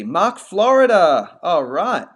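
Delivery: loud, drawn-out name with swooping pitch; hyped shout-out. Acted. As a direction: hail a viewer like a hype man, stretching the words. Hailing a viewer from Florida.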